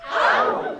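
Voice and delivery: laughing high shriek. Shown as a demonstration. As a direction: squeal it through a laugh. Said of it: "go, "Ow, [name]?".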